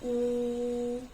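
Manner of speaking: ऊ held long and level; lengthened, even ऊ; long vowel sound. Shown as a demonstration. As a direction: sustain ऊ as a steady "oo". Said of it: "sound कैसी रहेगी?".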